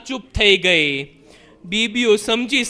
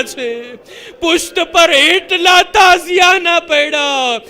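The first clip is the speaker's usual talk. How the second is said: wailing sermon; 4 semitones higher, 4 dB louder than usual. Acted. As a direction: cry it out to the mourners. Majlis lament peak.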